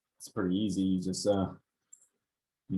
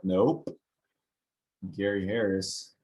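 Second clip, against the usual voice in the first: ordinary delivery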